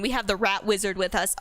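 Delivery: ordinary delivery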